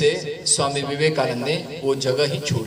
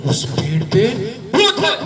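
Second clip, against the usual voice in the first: much higher and louder on the ghost; spooky reveal; 9 semitones higher, 4 dB louder than usual. Acted. Grandfather scares the boy about the ghost, spooky. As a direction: build up to a scary reveal.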